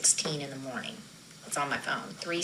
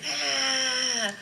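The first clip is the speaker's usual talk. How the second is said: uncle's scream; 8 semitones higher than usual. Retold or imitated. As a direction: echo the cry of agony, held back. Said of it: "He was screaming".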